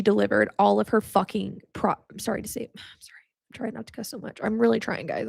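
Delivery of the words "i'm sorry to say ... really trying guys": hushed, apologetic aside; sheepish apology. Real, not acted. Speaker apologising to viewers for cussing, sheepish hushed aside. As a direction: drop far softer, almost whispering sorry. Apologising for swearing mid-story.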